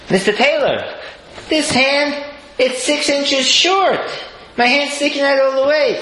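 high, pleading complaint voice; whiny groom. Retold or imitated. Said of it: "He's like".

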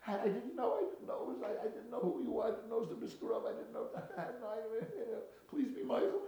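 high, stammering, frantic excuses; panicked pleading. Acted. Innkeeper learns who his guest was, frantic begging. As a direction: babble apologies and beg to be forgiven.

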